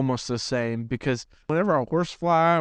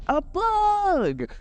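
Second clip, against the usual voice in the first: put-on much higher, panicked little-kid squeal; imitating a frightened crying kid; scared kid cry. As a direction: shriek it like a terrified child. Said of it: "And I'm, like, crying".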